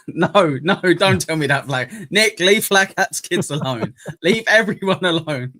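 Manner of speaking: laughing mock scolding.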